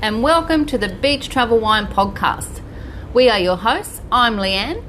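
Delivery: loud, bright show opener; podcast host intro. Acted. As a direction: sell the welcome like a radio host.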